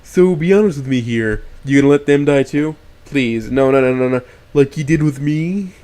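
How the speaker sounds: loud, cruel ghost voice; cruel taunting. A narrator's reading. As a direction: taunt him hard.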